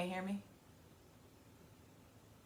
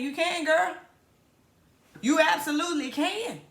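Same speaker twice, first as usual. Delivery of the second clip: higher and louder, cheering the viewer on; hype; 9 semitones higher, 5 dB louder than usual. Real, not acted. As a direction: pump her up like a best friend. Hyping the viewer up.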